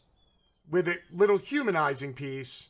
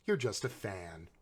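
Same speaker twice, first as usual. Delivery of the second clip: sarcastic punchline; 11 semitones lower, 11 dB quieter than usual. Pretend. Mocking the double standard, low and deadpan.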